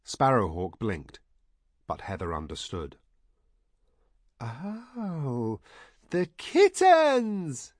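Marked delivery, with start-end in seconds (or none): 4.4-7.7 very high, delighted yell; shouted realisation. A narrator's reading. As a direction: let it dawn, then shout it slowly up high. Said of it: "she shouted".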